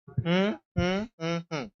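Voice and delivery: one soft hum per syllable; humming the syllable beats. Shown as a demonstration. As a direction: hum out each syllable to count it. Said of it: "उस word को हम्म करके बोलना है".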